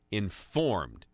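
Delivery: informed drawn out slowly, set apart; stress on informed. Real, not acted. Host clarifying to listeners which voters count, stress on informed. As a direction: lean hard and slow on "informed". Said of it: "Emphasis on the word informed".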